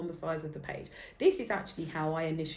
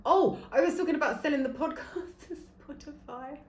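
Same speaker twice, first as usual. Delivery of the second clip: laughing through the words, then a high giggle; 8 semitones higher than usual. Real, not acted. Remembering her lost point, laughing at herself. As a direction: giggle at your own tangent.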